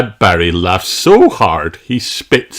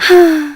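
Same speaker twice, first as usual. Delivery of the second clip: gasp; 5 dB louder than usual. Acted. Stranded at sea.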